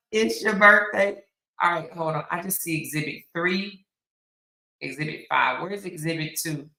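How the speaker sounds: ordinary delivery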